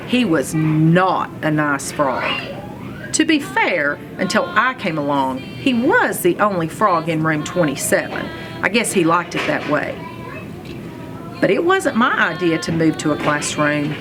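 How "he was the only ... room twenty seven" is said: usual speaking voice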